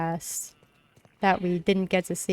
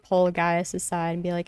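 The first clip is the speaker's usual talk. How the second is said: normal talking voice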